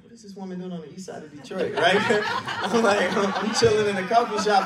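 loud, high, laughing through the words; laughing retelling. Retold or imitated. Trainee telling the audience how he met his mentor, laughing.

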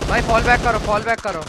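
loud urgent retreat order; cover me shout. Real, not acted. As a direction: bark the fall back call. (चिल्लाकर पीछे हटने का आदेश)

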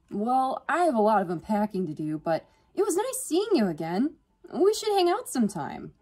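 high, loud, friendly sign-off; cheery. A narrator's reading. Crush heading off to unpack, cheery.

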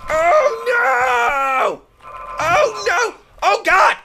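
panicked shouting. Real, not acted. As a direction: scream as the level goes wrong. Nearly failing the level.

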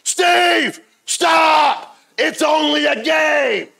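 high, loud scream of panicked pleading; imitated screaming. Fleeing friends beg Steve to quit shooting, imitated screaming. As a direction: scream it far up high, words drawn out. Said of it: "they start screaming".

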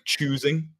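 "choosing" with a long, hard oo; hard oo sound. Shown as a demonstration. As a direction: lean on the oo in "choosing". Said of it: "a hard oo sound".

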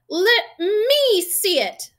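high, drawn-out bratty yell; whine-shout a demand, stretching it. A narrator's reading. Spoiled son wants to look, demanding loudly. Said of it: "demanded Dudley".

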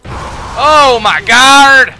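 slow, loud stretched-out Oh my God; real startled loud cry; startled scream. Real, not acted. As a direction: draw it out in a shocked wail. Startled scream in horror game.

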